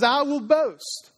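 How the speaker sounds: slow, lifted preaching emphasis; drawn-out emphasis. Acted. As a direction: stretch out "I will boast" with lift.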